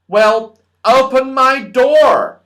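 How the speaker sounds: high, slow and loud command; Moonface voice. A narrator's reading. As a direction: call it out grandly, drawing it out. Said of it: "said Moonface".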